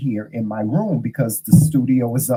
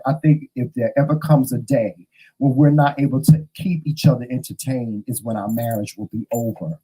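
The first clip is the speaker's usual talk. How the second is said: usual speaking voice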